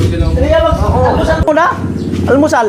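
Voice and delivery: playful high voice. Acted. Teasing call about breakfast.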